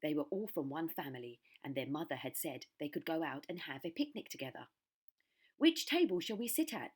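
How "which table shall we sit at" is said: put-on child voice. A narrator's reading. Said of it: "said one".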